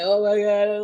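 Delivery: mock crying. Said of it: "like, I was crying".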